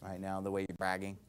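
very low, hushed mumble; embarrassed for them. Acted. Listener cringing at someone bragging, embarrassed undertone. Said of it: "you kinda get embarrassed for them".

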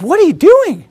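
high, laughing mock-amazed mom; surprised mom. Mom finding her son washing dishes, amazed and laughing. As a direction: act out a stunned mother, voice up high with a laugh.

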